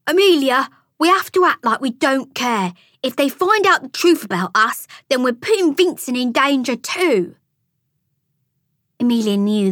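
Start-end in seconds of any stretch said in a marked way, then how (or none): none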